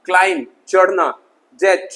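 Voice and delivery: ordinary delivery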